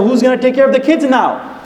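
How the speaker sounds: high-pitched retold complaint; upset wife. Wife left with the child in the store, upset. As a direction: push the question up in exasperation. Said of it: "the wife is getting upset".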